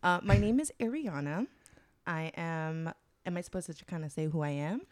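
ordinary talk

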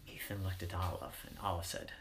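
ordinary delivery

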